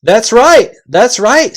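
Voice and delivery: loud, high and cheerful; cheerful chorus. Acted. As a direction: agree happily and loudly. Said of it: "said all the voices cheerfully".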